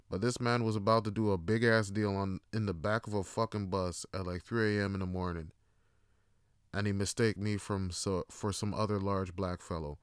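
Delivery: ordinary talk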